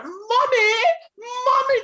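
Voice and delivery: put-on high-pitched little kid calling in a British accent; British-accented child. Mother imitating kids who watch Peppa Pig, British child voice. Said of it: "little kids now have British accents".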